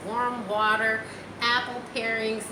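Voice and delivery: ordinary delivery, nothing marked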